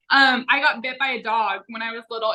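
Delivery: ordinary talk, nothing marked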